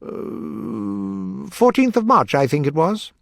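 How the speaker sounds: high, nervous little Hatter. Acted.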